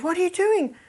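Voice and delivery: high-pitched indignant challenge; indignant husband. Acted. Husband to wife walking away, indignant.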